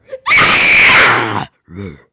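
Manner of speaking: huge, loud, low throat-tearing roar; monster roar. Pretend. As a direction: let out a massive monster roar. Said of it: "I'm gonna do the roar".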